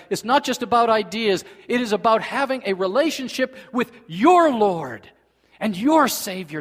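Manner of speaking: loud, high, ringing sermon voice; full-force preaching. Acted. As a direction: preach it out to the whole hall. Preacher drives doctrinal point home.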